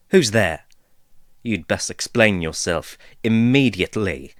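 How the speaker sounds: loud, demanding, on guard; sharp challenge. Acted. Caleb challenging an unseen intruder, loud and demanding. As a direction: call out a stranger in the dark.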